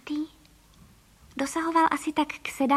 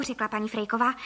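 plain reading voice

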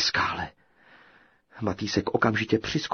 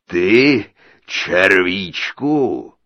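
loud, slow scornful ogre; mocking giant; 11 dB louder, much slower than usual. A narrator's reading. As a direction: sneer down at tiny him. (posměšný obr)

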